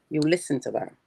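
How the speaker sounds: ordinary delivery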